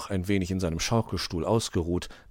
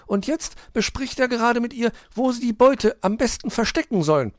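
put-on high, loud child-like hedgehog voice; excited little hedgehog; 6 dB louder than usual. A narrator's reading. Borstel tells Hoppel about the crooks, excited. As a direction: report the crime in a high, urgent voice.

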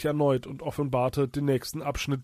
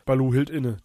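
ordinary delivery, nothing marked

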